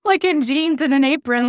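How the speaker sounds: high, loud, amused storytelling; laughing. Real, not acted. Baker recalling the bride seeing her in jeans, laughing. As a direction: tell it through real laughter.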